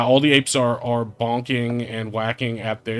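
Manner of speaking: ordinary talk, nothing marked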